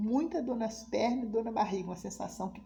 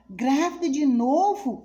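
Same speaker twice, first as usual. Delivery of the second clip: loud, slow, stunned question; shocked disbelief; 5 semitones higher, 9 dB louder, slower than usual. Retold or imitated. Neighbour reacting to news of another pregnancy, stunned and loud. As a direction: gasp it out in disbelief.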